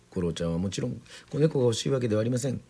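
plain reading voice

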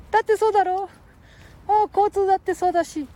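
put-on high squeaky boy voice; Ken-chan voice.

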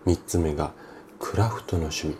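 usual speaking voice

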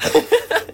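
sudden airy laugh; breathy burst of laughter. Real, not acted. Reacting to the awkward reading, airy laugh.

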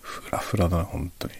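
tipsy, slurring a little. Real, not acted. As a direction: talk like you've had a drink.

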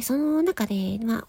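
usual speaking voice

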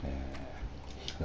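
ordinary delivery

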